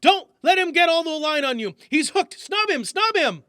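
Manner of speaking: loud, high yell to a fisherman. Acted. Yelling urgent fishing orders to old Jones. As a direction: shout the order over the reel. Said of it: "he yelled".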